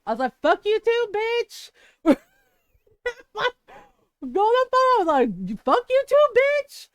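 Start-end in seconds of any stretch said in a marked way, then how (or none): 0.4-1.7 higher, louder retort, half playful; mock yell. Firing back at her aunt in the fight, mock yell. 5.6-6.9 much higher, louder shouted retort; full yell. Retold or imitated. Telling off her aunt in front of family, yelling.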